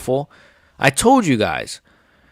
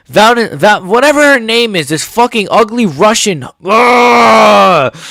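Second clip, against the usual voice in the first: loud, high fury ending in a groan of disgust; boiling over; 5 semitones higher, 4 dB louder, slower than usual. Real, not acted. Raging at Shevchenko until he must calm down, loud fury. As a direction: lose it, then groan. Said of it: "I gotta chill out".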